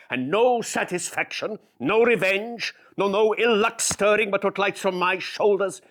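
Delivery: Shylock's mounting fury; Shylock ranting. Acted. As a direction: build the self-pity into a howl. Shylock's self-pitying rant.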